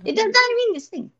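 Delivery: high mock accent. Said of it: "You keep saying that word".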